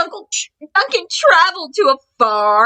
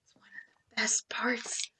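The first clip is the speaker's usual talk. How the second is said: hushed whisper; 8 semitones lower, 17 dB quieter than usual. Real, not acted. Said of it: "aside to children".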